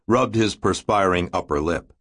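usual speaking voice

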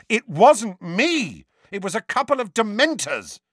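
high, emphatic angry protest; temper rising. A narrator's reading. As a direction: protest with voice climbing. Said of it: "temper and voice both rising".

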